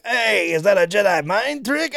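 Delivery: put-on voice swooping up high; Watto. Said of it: "he goes to Watto".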